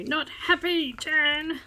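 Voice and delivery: high, loud call from afar; mock yell. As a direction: yell it out the window. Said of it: "she yells out the window".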